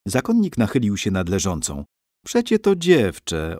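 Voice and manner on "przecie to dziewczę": put-on a touch higher, old monk's voice; lightly voiced old monk declaring; monk announcing. A narrator's reading. Monk finds a girl, declares it, higher. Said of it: "oznajmił".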